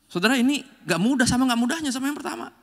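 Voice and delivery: ordinary talk, nothing marked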